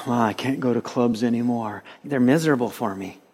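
soft sighed mock complaint; mock resignation. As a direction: sigh it out quietly, feigning reluctance. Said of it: "It wasn't like I go".

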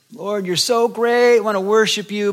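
acted-out eager worshipper; gushing mock prayer. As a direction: pray effusively in a put-on voice. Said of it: "you're seeking the Lord".

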